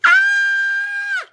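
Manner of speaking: very high, sustained squeal; excited squeal. Real, not acted. Streamer thrilled by Rena, excited squeal. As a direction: squeal long and high with delight.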